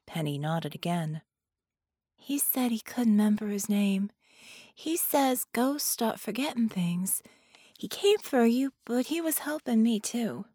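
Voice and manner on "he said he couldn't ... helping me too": put-on higher, childlike little-girl voice; earnest small child explaining. Little girl reports what the ghost told her, earnest child voice.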